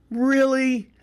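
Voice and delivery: high-pitched, sceptical "Really?"; mock disbelief. Family doubting his Thanksgiving story, imitated in high-pitched disbelief. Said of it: "looked at me like".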